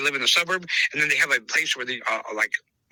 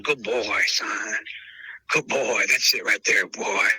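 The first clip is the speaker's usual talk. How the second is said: low, gentle praise for a good dog; tender voice praising a dog; soothing dog praise; 7 semitones lower, slower than usual. Shown as a demonstration. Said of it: "That voice right there".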